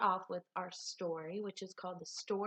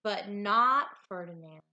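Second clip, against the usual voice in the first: lively, expressive storybook reading; animated read-aloud with big swoops in pitch; reading to children; 4 semitones higher, 6 dB louder than usual. A narrator's reading. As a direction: read it like a picture book for a class. Teacher reads picture book aloud.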